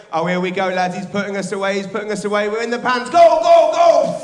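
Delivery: loud, high cheering; rising mock chant. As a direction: egg it on to the finish. Cheering the finish on.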